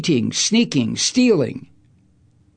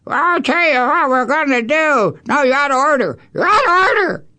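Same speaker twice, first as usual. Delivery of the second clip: mocking higher-pitched old senator voice; Chuck Grassley; 8 semitones higher than usual. Host mimics old senator ruling out of order, high caricature. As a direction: caricature an ancient senator's accent, rising to a higher cry. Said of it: "the way he talks".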